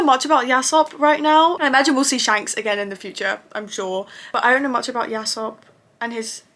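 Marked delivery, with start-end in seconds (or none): none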